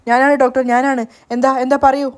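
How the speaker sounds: usual speaking voice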